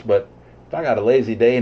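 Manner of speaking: usual speaking voice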